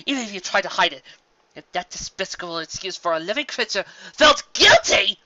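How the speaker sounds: loud, high, raging shout; furious. A narrator's reading. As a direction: hammer each point home in rage. Luna's rant continues, cut off.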